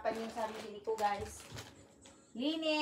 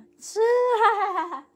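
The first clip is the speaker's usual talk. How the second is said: high burst of delighted laughter; high, loud delighted laugh; 10 semitones higher, 10 dB louder than usual. Real, not acted. Mother gushing over baby's outfit.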